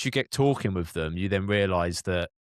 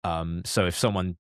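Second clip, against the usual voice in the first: normal talking voice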